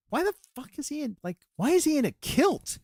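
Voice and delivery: imitating high-pitched bafflement; baffled disbelief. Girlfriend questioning the actor's kilt, baffled.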